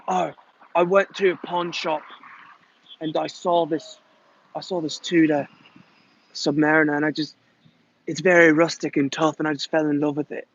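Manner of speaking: light British accent imitation; lightly voiced Beckham in a British accent; a hint of a British accent, retelling his pawn shop story; David Beckham impression. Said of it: "I'm David Beckham".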